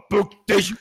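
high-intensity growled German metal shout; mock industrial-metal bark. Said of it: "from the Rammstein song".

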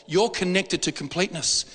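normal talking voice

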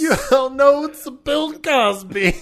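slow, loud, high put-on voice; goofy impression voice. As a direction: ham it up slowly.

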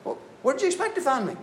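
put-on a kid stating the obvious to his mom; matter-of-fact boy. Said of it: "He's just like".

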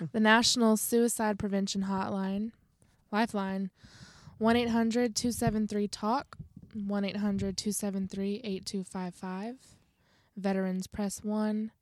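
best Southern voice for a hotline read; measured Southern-accented announcement; put-on Southern accent. Co-host reading crisis lines on request, Southern accent. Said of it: "in your best Southern voice".

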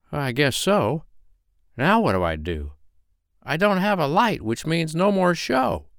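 little firefly's rising lament; lightly voiced dejected firefly. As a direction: let the pitch climb as he frets.

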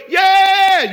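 high, loud cheer; cheerleader yell. Retold or imitated. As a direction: whoop like a cheerleader. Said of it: "Cheerleaders only".

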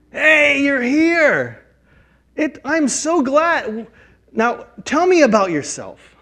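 high, loud, delighted greeting; light up and welcome them in; joyful welcome. Pretend. Church member greeting a first-time visitor, thrilled.

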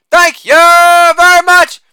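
Holla barked loud, then thank you very much stretched slow and very high; shouted catchphrase. Acted. Comic to audience, hammed-up sign-off, loud high drawn-out shout. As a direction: belt the sign-off like a showman milking applause.

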